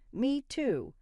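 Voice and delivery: put-on a child joining in, keen; eager exclaim. A narrator's reading. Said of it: "Hannah exclaimed".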